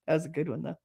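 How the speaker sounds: ordinary talk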